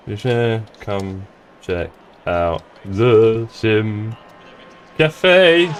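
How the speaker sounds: slow, halting, word by word; typing aloud. Real, not acted. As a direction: stretch each word while you type it. Typing a message while saying it.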